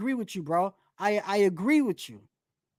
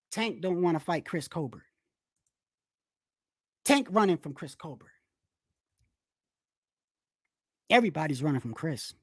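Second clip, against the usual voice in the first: lower, deadpan mocking voice; mock claim; 7 semitones lower, 3 dB quieter than usual. Showing the chat how silly the claim sounds, lower and deadpan. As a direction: sound it out as if you half believe it. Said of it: "imagine if I said to you".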